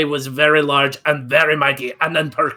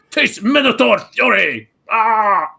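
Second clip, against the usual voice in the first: a high-pitched, loud battle cry; minotaur battle cry; 8 semitones higher, 6 dB louder, slower than usual. Acted. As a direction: charge in with "Taste Minotaur fury!".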